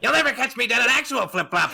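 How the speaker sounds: high, loud, hammed-up cartoon villain; Joker. Shown as a demonstration. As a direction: belt it out like a maniacal supervillain. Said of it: "but in the Joker's voice".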